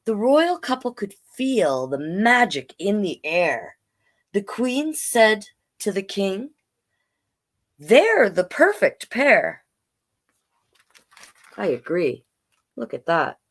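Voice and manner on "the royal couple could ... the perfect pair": storybook read-aloud in rhyme; story narration. A narrator's reading. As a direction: tell it gently like a bedtime tale.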